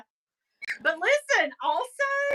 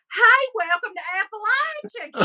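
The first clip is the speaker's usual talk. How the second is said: exaggerated Southern accent; 5 semitones higher than usual. Friends joking in Tennessee, hammed-up Southern accent. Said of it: "ratchet up to see who can be more [name]".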